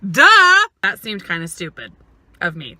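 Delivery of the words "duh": loud, high self-mocking duh. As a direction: smack your own forehead with the word. Realising her own silly question.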